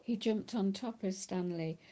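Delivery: plain reading voice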